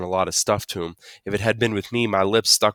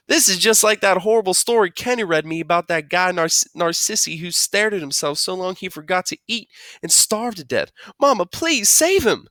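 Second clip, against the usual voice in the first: put-on high, distressed little-kid wail; blubbering; 11 semitones higher, 4 dB louder than usual. A narrator's reading. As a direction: blubber it out, begging mom. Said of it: "Joey blubbered out".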